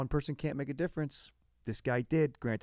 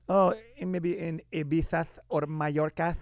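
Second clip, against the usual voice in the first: hammed-up Castilian lisp, pushed louder and higher; Castilian accent; 5 semitones higher, 5 dB louder than usual. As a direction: put on a loud mock Spanish accent. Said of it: "I think it's like an accent".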